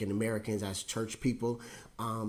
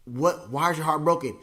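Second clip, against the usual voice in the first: raised pitch, louder, incredulous; mock baffled question; 5 semitones higher, 6 dB louder than usual. Preacher mimicking a puzzled follower, louder and higher. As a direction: demand to know what happened.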